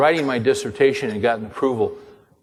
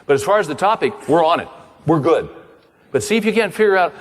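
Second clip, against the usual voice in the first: high, lilting encouraging voice; cheerful professor; 6 semitones higher than usual. Retold or imitated. Professor reassuring his student about the topic, higher and lilting. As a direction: coax him gently like a kindly mentor. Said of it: "He goes".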